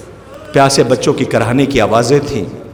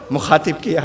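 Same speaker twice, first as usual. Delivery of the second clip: ordinary talk, nothing marked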